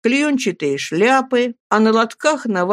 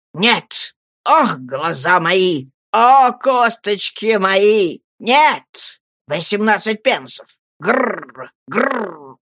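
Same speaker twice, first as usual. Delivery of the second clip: put-on wailing old man; much slower than usual. A narrator's reading.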